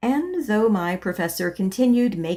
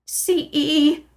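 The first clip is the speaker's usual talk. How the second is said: sobbed; 8 semitones higher, 4 dB louder than usual. Acted. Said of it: "I sobbed".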